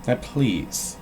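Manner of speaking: storybook read-aloud voice; playful bedtime story narration; reading to children. Acted. Reads Grinch story aloud as bit.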